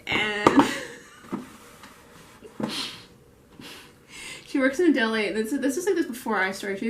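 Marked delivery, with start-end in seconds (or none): 2.4-3.0 short breathy laugh; real suppressed laugh. Real, not acted. Recalling a coworker's story, amused breathy laugh.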